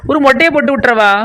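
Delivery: ordinary talk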